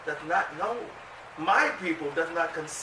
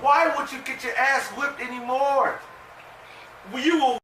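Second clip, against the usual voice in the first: high, loud preaching question; 8 semitones higher, 4 dB louder than usual. Acted. Preacher hammering home the Lord's warning.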